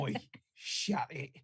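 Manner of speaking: gruff London tough-guy impression, lightly done; a light Cockney heavy telling someone off; Cockney-accented tough-guy retort; Ray Winstone impression. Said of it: "pure fucking Ray Winston".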